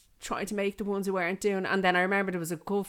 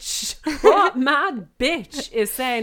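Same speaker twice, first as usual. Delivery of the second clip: high, very loud mock fury; hammed-up demand to know who did it; outraged; 8 semitones higher, 11 dB louder, slower than usual. Acts outrage at complaining parent.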